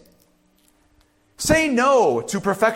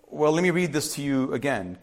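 usual speaking voice

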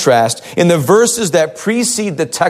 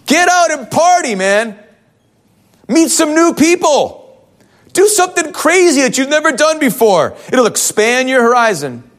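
mock party advice; 7 semitones higher than usual. Voicing the world's advice.

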